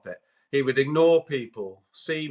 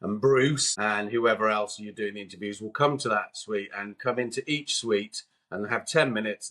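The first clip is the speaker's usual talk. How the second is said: ordinary talk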